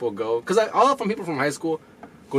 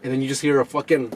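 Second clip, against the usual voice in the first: normal talking voice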